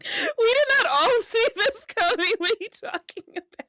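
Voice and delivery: high, loud, laughing disbelief; mock outrage. Real, not acted. Host protesting her cohost's claim, in high laughing disbelief. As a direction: protest in playful shock.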